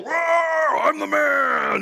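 hammed-up macho roar; mock macho roar. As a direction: puff up like a roaring alpha. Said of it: "After that whole".

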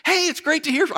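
high, chirpy replay of a phone hello; mock-delighted to hear from a friend; over-cheery greeting. Replaying a badly misjudged answer to a friend's call, over-cheery.

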